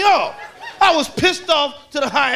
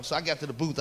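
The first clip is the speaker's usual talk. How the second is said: ordinary delivery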